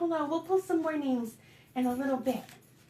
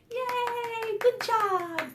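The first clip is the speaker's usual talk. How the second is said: high-pitched happy cheer; cheering praise; 9 semitones higher, much slower than usual. Real, not acted. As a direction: cheer the little ones on. Cheering children after the song.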